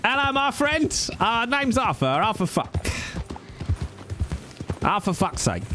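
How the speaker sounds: high-pitched little travelling merchant; Arthur. Pretend. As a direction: put on a small, high hawker's voice. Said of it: "My character from Zweihander. Him".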